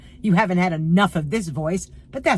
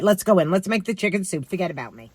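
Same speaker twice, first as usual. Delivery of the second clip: usual speaking voice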